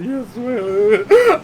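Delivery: put-on high, loud submissive kid; meek kid. Son giving in to mom, high meek "yes ma'am". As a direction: give in to mom.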